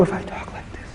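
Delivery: soft breathy whisper to show closeness. Shown as a demonstration. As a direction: drop to a bare whisper, barely audible. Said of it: "'cause I'm talking really low".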